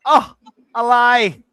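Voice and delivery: very high, loud mock Thai wail; Thai accent impression. Thai wife reacting to a footy blunder, high loud mock accent. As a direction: shriek it in a hammed-up Thai accent. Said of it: "like, Thai women".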